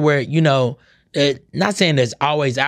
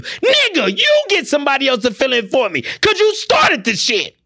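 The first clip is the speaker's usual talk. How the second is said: outraged retort; 7 semitones higher than usual. Retold or imitated. To the boss who says find your own cover, high outraged retort.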